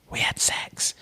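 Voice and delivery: imitating a hushed, whispered reveal; whispered confession. Woman telling interviewers about last night, mock confiding whisper. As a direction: whisper it like an intimate secret.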